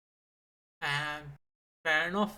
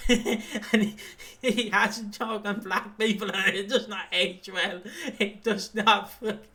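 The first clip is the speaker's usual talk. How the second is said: loud, slow, laughing through words; 4 semitones higher, 4 dB louder, slower than usual. Real, not acted. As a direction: giggle while telling it.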